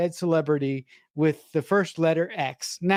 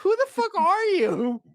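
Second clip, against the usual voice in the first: much higher, squeaky mock disbelief; incredulous mock outrage. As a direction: shriek the question in cartoonish disbelief. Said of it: "I'm like".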